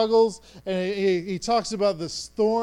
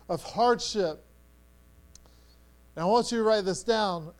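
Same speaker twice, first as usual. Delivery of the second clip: ordinary talk, nothing marked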